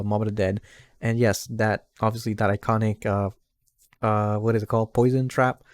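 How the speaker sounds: usual speaking voice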